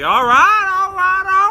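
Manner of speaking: very high, loud repeated agreement; mock eagerness. Cops agreeing to the bacon offer, high eager cry. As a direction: agree in a hammed-up excited squeal.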